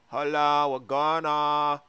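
slow, stretched singsong question; singsong Drake. Acted. Drake overhears his friends, slow singsong. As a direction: drawl it out as if singing. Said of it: "sounds like he's singing or something".

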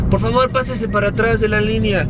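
imitating a stern museum guard; mock guard warning. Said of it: "uno se dijo".

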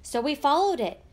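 fast, loud happy retelling; excited rush. A narrator's reading. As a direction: tumble the words out in joy.

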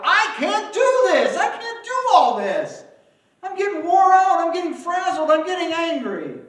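high, loud worn-out complaint; loud, high voice of someone frazzled and fed up; exasperated outcry. Retold or imitated. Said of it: "where I said out loud".

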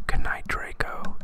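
breathy whisper; whispered goodnight. Acted. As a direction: hush it so no one hears. Kids say goodnight on quiet stairs.

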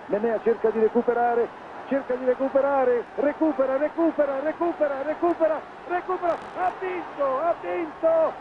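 building to a high-pitched climax; breathless italian goal commentary. Real, not acted. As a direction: pitch climbing with every recupera. Race finish, commentator climax. (telecronaca trafelata che sale fino al grido)

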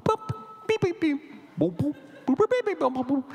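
very high, chirpy synth beeps; electronic beeps. Retold or imitated. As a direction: imitate a toy synthesizer bleeping. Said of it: "was boop".

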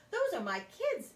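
a scratchy cry of disbelief; scratchy teacher voice. Acted. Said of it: "she said in a scratchy voice".

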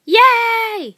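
high, loud, drawn-out yay; excited cheer. Pretend. Friends remembering to say yay, shouted cheer. Group cheer they forgot earlier.

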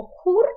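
Polish "хур" said high and loud, ó as a clear u; teacher shows the Polish "хур"; Polish word. Shown as a demonstration. Teacher shows students the Polish word, said clearly. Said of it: "маємо польське слово".

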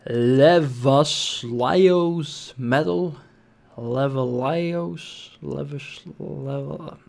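slow, halting tries at "Levaslios", pitched questioningly; struggling pronunciation. Real, not acted. Gamer apologizes for mangling a medal name, struggling slowly through it. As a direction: sound out "Levaslios" uncertainly, stretching it, then breaking off. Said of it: "forgive me for pronouncing this in such a bad way".

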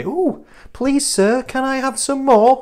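high, slow mock begging voice; pleading beggar. Coach mimicking a dieter begging for food, high pleading voice. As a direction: plead like a hungry orphan asking for seconds.

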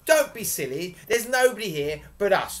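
high, loud, brisk telling-off; scolding. A narrator's reading. Giantess telling her husband off, scolding. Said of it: "the giantess scolded".

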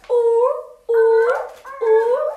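high, loud hooting ooh; mock walrus hoots; walrus ooh calls. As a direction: hoot like a big silly walrus. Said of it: "What sound does a walrus make?".

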